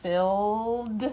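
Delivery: "filled" with a long, loud D ending; exaggerated word endings. Shown as a demonstration. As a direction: stretch the D at the end of "filled". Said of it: "the D".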